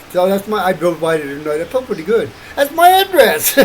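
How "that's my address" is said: much higher and louder, gleeful; excited outburst. Real, not acted. To himself about his address, gleeful and loud. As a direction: blurt it out proud and delighted.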